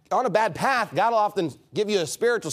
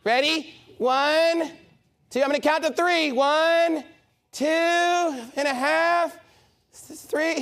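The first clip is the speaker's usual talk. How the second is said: higher-pitched imitation of a mom counting; mom counting warning; 8 semitones higher, slower than usual. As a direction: draw out the count like a stalling parent. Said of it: "the mom's just like".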